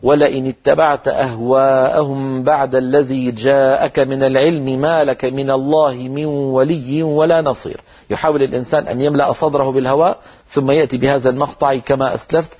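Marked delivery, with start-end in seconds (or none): 0.0-7.8 slow, one unbroken Quranic phrase; connected recitation. Shown as a demonstration. Teacher demonstrating a connected start to students, slow recitation. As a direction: recite it all in one breath, slowly. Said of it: "لابد أن يكون الكلام متصلا بعضه ببعض هكذا".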